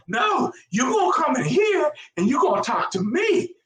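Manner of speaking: very high, insistent mock demand; bossy woman imitation. Drunk woman ordering officer to talk to her, mock bossy. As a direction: put on an indignant woman's high voice.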